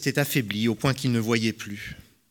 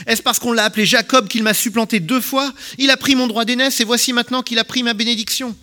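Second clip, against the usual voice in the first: high, loud, wailing complaint; bitter outcry; 11 semitones higher, 7 dB louder than usual. A narrator's reading. Esau cries out to his father against Jacob, bitter and loud. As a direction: lament it loudly, furious at his brother.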